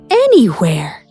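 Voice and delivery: usual speaking voice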